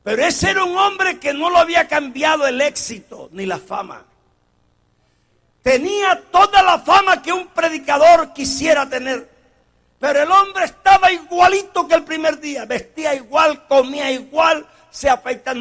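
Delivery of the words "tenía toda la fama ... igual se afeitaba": forceful preaching. Acted.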